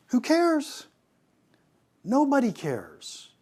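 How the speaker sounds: high, scoffing who cares; exasperated who cares. Acted. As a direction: toss it off with disbelief. Dismissing a pointless product feature.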